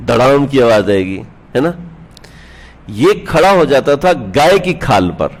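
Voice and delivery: ordinary delivery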